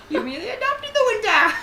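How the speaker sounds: very high squeaky villain lines; super high-pitched voice. Pretend. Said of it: "Just have a super high-pitched".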